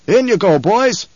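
high, forceful snapped command; snapped order. A narrator's reading. Halleck hurrying the men aboard, snapped. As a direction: snap it out, pitched well up. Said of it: "he snapped".

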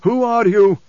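loud, high-pitched challenge; demanding preacher. A narrator's reading. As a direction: demand it forcefully of the stranger. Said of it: "the preacher demanded".

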